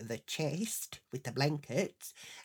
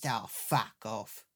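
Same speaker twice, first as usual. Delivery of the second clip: weary dismissal; 6 semitones lower, 4 dB quieter, slower than usual. Retold or imitated. Husband brushing her off.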